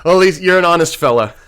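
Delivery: chuckling through the words; amused laugh while talking. Real, not acted. Amused reply to blunt answer.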